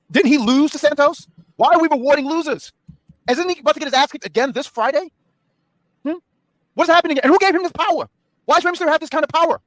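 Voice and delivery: worked-up rant. Real, not acted. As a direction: rant in disbelief, pitch way up. Heated rant about Rey's power.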